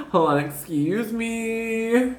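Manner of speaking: high, very slow, stretched-out interruption; drawn-out excuse me. Acted. Trying to push away the energy, drawn-out excuse me. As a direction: stretch it out high to hold off an intruder.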